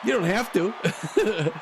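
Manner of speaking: talking through real laughter; words squeezed out between laughs; laughing. Real, not acted. Laughing at his partner's bad pitching, words through real laughter.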